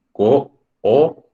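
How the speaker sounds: "cooperación" in slow, separate syllables. Shown as a demonstration. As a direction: split co, o, pe, ra, ción with clear gaps. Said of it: "cómo sería el silabeo".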